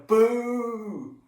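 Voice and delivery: put-on a playful boo for the kids to copy; booing the baddie. Shown as a demonstration. Leading children in booing Judas, a light boo. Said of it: "So I want you to go".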